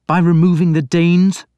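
high, loud sneering question; bitter question. A narrator's reading. Alfred to the priest, bitterly sneering. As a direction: throw the question back bitterly. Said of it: "Alfred asked bitterly".

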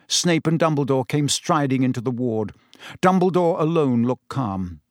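plain reading voice

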